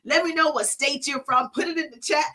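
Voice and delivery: usual speaking voice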